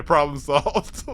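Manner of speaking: ordinary delivery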